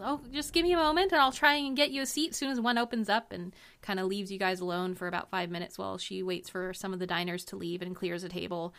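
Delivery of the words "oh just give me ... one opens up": higher, hurried waitress voice; busy waitress. Acted. Busy waitress asking diners to wait for a seat, hurried. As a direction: fob them off politely in a rush, pitched up. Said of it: "she looks at you and, uh, says".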